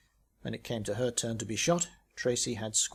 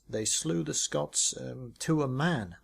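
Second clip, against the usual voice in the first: slow, deciphering each word; reading the stone aloud; reading inscription; slower than usual. Acted. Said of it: "down here it says".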